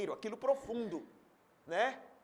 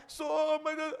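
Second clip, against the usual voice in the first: higher-pitched pretend sobbing; mock crying; 5 semitones higher, much slower than usual. Preacher playing the scolded crier admitting laziness, mock tearful. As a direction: whimper it in exaggerated self-pity. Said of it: "Fulano tá chorando por quê?".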